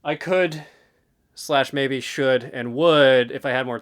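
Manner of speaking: romantic, slow, swelling read. Shown as a demonstration. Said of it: "Let's get more romantic".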